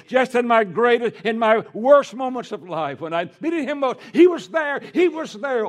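climbing high and loud to a preacher's peak; preaching at full force. Acted. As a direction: build it up until the congregation shouts back. Sermon climax.

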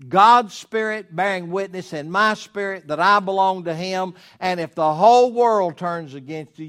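high, loud preaching, slowing on key words; preaching at full force. Acted. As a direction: build the sermon to a fervent peak. Preaching with rising intensity.

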